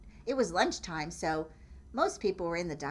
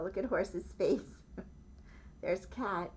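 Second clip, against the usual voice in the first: ordinary delivery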